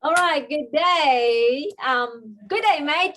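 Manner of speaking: playful Australian greeting, slow, high and loud; attempted Aussie accent. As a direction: ham up an Australian good day. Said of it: "my attempt of trying to greet everybody ... with my Aussie accent".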